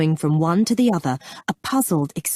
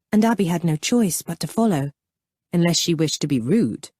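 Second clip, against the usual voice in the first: usual speaking voice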